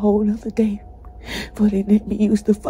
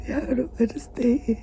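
normal talking voice

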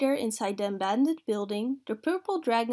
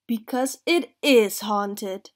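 slow, loud, ominous declaration; spooky pronouncement; 6 dB louder, slower than usual. A narrator's reading. A face in the dark scaring the dragon, spooky and slow. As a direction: stress it slowly to scare.

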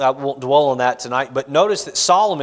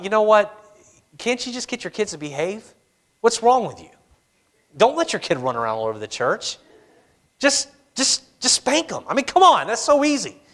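smug know-it-all; 5 semitones higher than usual. Retold or imitated. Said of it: "you thought".

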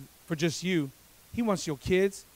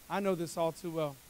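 ordinary delivery

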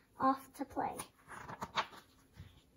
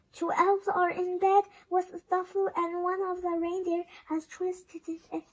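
higher-pitched elf voice, not truly whispered; whispering elf; 6 semitones higher than usual. A narrator's reading. Elf telling Santa of trouble, high character voice. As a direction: report it in a small high elf voice. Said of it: "elf whispered into his ear".

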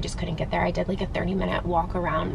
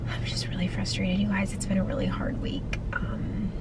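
weary whisper; 7 dB quieter than usual. Real, not acted. Tired venting to viewers.

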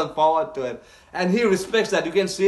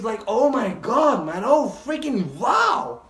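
slow, high-pitched amazement; awed exclamation; 5 semitones higher, slower than usual. Real, not acted. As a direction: draw out the words in wonder. Overwhelmed by the show.

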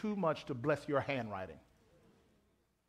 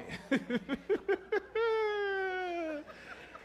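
laugh; 13 semitones higher than usual. Real, not acted. Laugh before mock testimony.

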